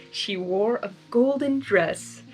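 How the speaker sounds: usual speaking voice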